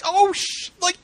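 yelling at the page. Retold or imitated. Ranting to co-hosts, a high laughing yell. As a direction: scream at the characters to fight back. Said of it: "I'm like".

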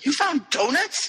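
high, drawn-out incredulous question; hammed-up amazed query, pitched high; acted astonishment. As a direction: stretch it out in mock disbelief. Acted disbelief at found donuts.

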